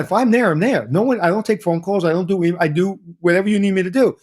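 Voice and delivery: usual speaking voice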